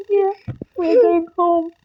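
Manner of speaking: high sobbing happy words; crying with joy. Real, not acted. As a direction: sob it out with joy. Overjoyed, crying.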